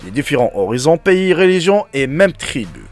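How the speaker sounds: steady storyteller read-aloud voice; story narration. Acted. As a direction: read it like a moral tale. Reads a story to viewers.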